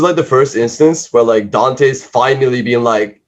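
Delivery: ordinary talk, nothing marked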